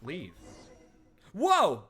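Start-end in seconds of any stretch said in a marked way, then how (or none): 1.3-1.8 startled whoa. Real, not acted. Jump scare in game.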